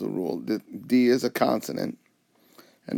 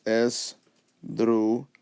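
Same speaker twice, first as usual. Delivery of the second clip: "esdrújula" slowly split as es, dru, ju, la; divided into syllables; much slower than usual. Shown as a demonstration. Teacher dividing the word by syllables, "esdrújula" slow and separated. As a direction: pause between each syllable of "esdrújula". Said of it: "divide the word by, by consonants, syllables".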